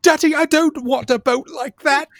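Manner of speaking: high-pitched put-on kid voice; spoilt child impression. Imitating a child refusing the boat, high childish protest. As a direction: complain to daddy like a spoilt child.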